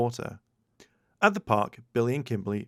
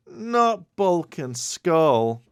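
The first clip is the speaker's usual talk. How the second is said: moaning; 7 semitones higher, 7 dB louder, much slower than usual. A narrator's reading. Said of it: "Kimberly moaned".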